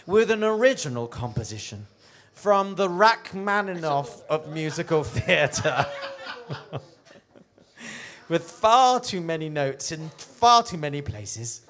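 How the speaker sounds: booming showbiz introduction; grand announcer. Acted. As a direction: build to a loud announcer shout. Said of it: "introduce it".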